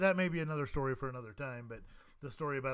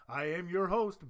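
ordinary delivery

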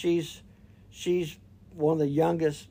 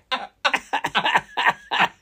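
high, loud burst of real laughter; loud laughing burst; 10 semitones higher, 8 dB louder than usual. Real, not acted. Laughing at the deer story, loud burst.

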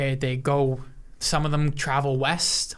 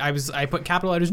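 usual speaking voice

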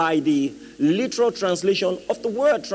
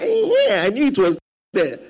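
mimicked woman bluffing; 5 dB louder than usual. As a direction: imitate her pretending she knew. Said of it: "She said".

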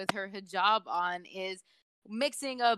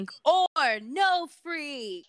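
stretched-out, higher, quiz-show announcing; game-show host; 5 semitones higher, 4 dB louder, much slower than usual. Acted.

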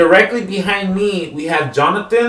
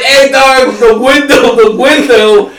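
high, loud, laughing mock cry; imitated pained yell; 8 semitones higher, 7 dB louder than usual. Friend with his fingers stuck in the window, mock yelling. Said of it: "Jonathan's going".

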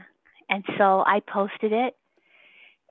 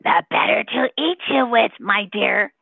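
wolf voice; 2 semitones higher, 5 dB louder than usual. Retold or imitated. Grandmother telling her grandson a story in a wolf voice. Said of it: "And then I would say in my wolf voice".

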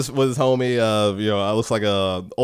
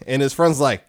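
usual speaking voice

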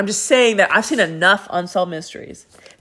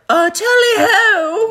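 high, loud mock British tally-ho; drawn-out, high-pitched hammy British accent; a silly British toff's call, sung out high; 13 semitones higher, 5 dB louder, slower than usual. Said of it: "British accent".

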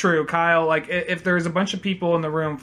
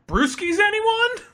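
silly high call; slower than usual. Pretend. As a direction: sing out the offer. Offering beers in a silly voice.

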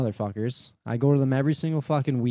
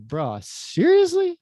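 much higher, slow drawn-out disbelief; incredulous bruh; 5 dB louder, much slower than usual. Retold or imitated. Driver retelling his shock at the managers, high and drawn-out.

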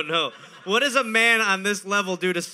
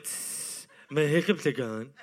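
a hissing fizzle then a pleased little line; sizzle, then calmly announce the cure; mock relieved drunk; 5 semitones lower, 4 dB quieter, slower than usual. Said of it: "he's like".

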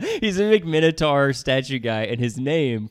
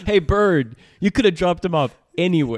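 mock scolding call; 6 semitones higher, 6 dB louder than usual. Scolding the bird in mock quote.

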